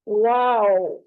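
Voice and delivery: वाव with lips rounded. Shown as a demonstration. Teacher showing students the letter's articulation, rounded lips. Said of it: "होंठों को गोल करना है".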